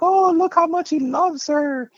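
high, loud, slow gushing voice of onlookers; hammed-up sighing onlooker; mock wedding guests. Guests at the wedding watching him cry, gushing mock voice. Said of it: "people are looking at me like".

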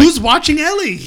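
high, loud mock demand; mock outraged shout. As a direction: burst out with a comic holler. Mock outburst over absent parents.

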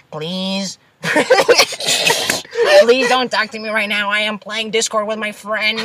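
put-on whiny gamer girl, cracking up; nerdy girl voice. Imitating an imagined nerdy girl.

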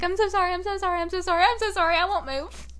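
high, rapid mock apology; squeaky panicked sorry; frantic apology. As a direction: imitate a flustered boyfriend saying sorry over and over. Boyfriend apologizing in retold quote.

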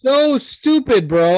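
loud, high and drawn out disgust; fed up, let it rip; exasperated. Real, not acted. Ranting about booing fans.

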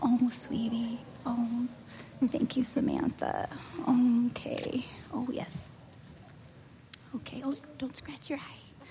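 soft, hushed whisper over a sleeping infant. Real, not acted. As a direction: keep it to a whisper so she stays asleep. Baby just fell asleep.

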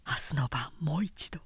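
hushed pleading whisper. A narrator's reading. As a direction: whisper it through the hedge. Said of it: "男がささやいた".

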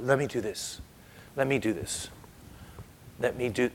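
soft, quiet coaxing; gentle Jesus. Retold or imitated. Jesus asking the blind man to allow it, soft. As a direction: coax him softly to allow it.

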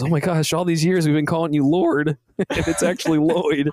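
hammed-up dawning wonder; amazed character. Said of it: "He goes".

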